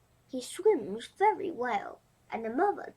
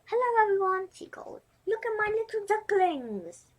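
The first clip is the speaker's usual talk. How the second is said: high, bright call to the whole farm; proud showing off; 9 semitones higher, 3 dB louder than usual. Acted. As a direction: show off her babies with pride. Mother duck shows off ducklings.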